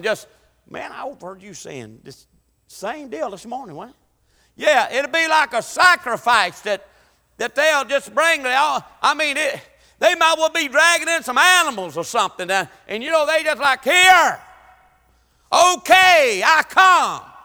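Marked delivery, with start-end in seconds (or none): 0.7-4.7 much softer gossiping aside; mock murmur. Preacher imitating a churchgoer gossiping, much softer. 13.8-17.3 louder, higher grudging cry; hammed-up reluctant offerer calling out; mock grudging shout. Preacher mocking people dragging in their offering, loud.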